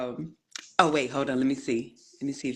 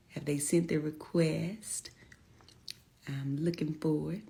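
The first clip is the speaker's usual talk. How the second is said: softer whisper to herself; whispered aside; 7 dB quieter than usual. Real, not acted. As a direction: whisper it while searching. Muttering while checking screen.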